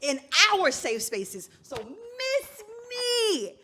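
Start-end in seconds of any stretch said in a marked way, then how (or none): 1.8-3.5 stretched high, loud plea; drawn-out exasperation. Real, not acted. Co-host fed up with shared safe spaces, exasperated. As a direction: drag both words out high and long. Heated demand to be spared.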